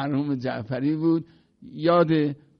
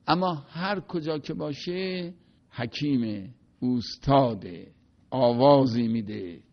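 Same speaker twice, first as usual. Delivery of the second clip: normal talking voice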